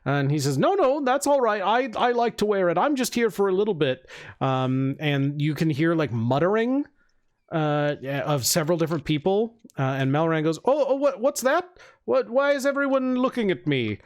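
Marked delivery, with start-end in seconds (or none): 0.6-4.1 higher, hurried reassurance; higher voice, gently insisting; politely declining spellcaster. Acted. 10.6-14.0 high, nervous questioning; much higher, startled voice; flustered Melran. Acted. Melran startled by everyone staring.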